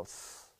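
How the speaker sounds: soft hiss through the teeth; imitated hiss. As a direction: hiss like a scornful passerby. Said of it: "they'll go".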